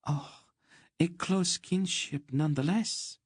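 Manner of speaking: very high, warmly approving lady. A narrator's reading. Countess approving Geryon's kinship, warm and very high. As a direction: approve with sweet warmth. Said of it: "she approved warmly".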